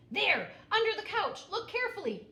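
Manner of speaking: high, loud eager pointing out; excited call. A narrator's reading. Pete telling the clown where to look, loud and high. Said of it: "Pete said".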